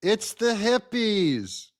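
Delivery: sarcastic mock delight. Mocking the protesters.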